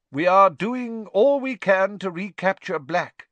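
a high, loud official voice; pompous minister. A narrator's reading. Minister Fudge reassures the press, loud and high. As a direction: make it an anxious public statement.

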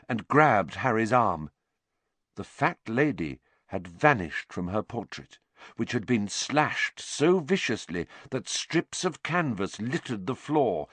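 ordinary delivery, nothing marked

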